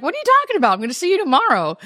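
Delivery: high, incredulous question; surprised caregiver. Retold or imitated. Caregiver to dying woman, incredulous and high. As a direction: caregiver brushing it off, puzzled.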